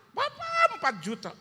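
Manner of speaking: very high-pitched imitation of an excited child; anak berseru. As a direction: squeal it like the pastor's child. Child's excited cry in story. (tiruan anak berseru melengking)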